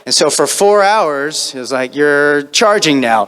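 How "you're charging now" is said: loud, slow, raised cue; imitated shouted direction; director calling out. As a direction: call out the action like a director. Director calling out goblin actions.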